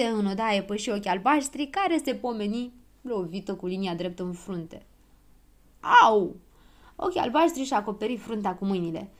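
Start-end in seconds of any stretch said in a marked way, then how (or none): 6.0-6.4 loud, sudden "ow"; cry of pain. A narrator's reading. Girl hit on the forehead with a ruler, loud yelp.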